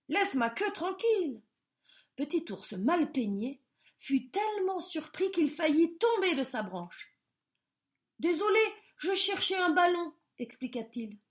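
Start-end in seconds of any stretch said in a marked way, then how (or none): 0.1-1.4 higher, cross squirrel voice; annoyed squirrel. A narrator's reading. Squirrel scolding the bear, higher and cross. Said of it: "cria l'écureuil". 8.2-10.1 higher, louder flustered apology; startled apology. A narrator's reading. Bear apologising to the squirrel, higher and flustered.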